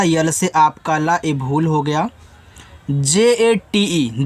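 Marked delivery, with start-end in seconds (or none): 2.9-4.2 letters spelled out one by one, very slowly; slow letter-by-letter spelling. Shown as a demonstration. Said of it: "जाते".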